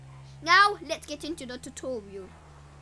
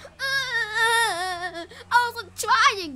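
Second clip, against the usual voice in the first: high, loud, whiny mock sob; hammed-up fake crying; mock whiny newbie; 6 semitones higher, 7 dB louder, much slower than usual. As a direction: whine like a clueless small youtuber. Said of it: "those people who just got".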